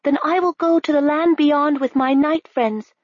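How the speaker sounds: put-on high, resolute girl's voice; high determined princess. A narrator's reading. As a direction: vow it bravely. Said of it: "said Princess Nell".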